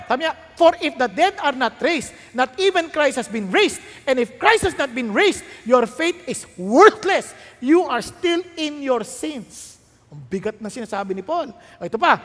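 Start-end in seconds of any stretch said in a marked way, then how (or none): 0.6-9.8 louder, with the ending slowed right down; thundering scripture reading; loud preached reading. Shown as a demonstration. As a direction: proclaim the verse to the hall. Reads Paul's verse again, louder.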